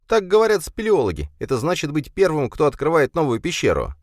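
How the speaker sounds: put-on boy voice. A narrator's reading. Jason explaining to mom.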